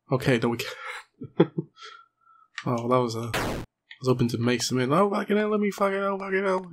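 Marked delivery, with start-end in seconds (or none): 4.9-6.7 high, panicky put-on cursing voice; imagined game character's voice. Player voicing the on-screen character in panic, high put-on voice. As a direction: squeal the swearing as the imagined little character. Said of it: "it's just how I imagine him speaking".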